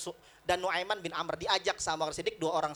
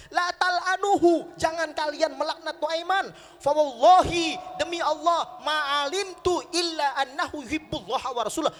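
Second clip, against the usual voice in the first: emphatic rebuke; 8 semitones higher, 5 dB louder than usual. Retold or imitated. The Prophet forbids companions to curse Nuaiman, emphatic rebuke. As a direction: forbid it firmly in a raised preacher's voice.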